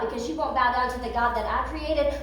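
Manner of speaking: ordinary talk, nothing marked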